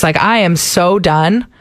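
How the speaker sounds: ordinary delivery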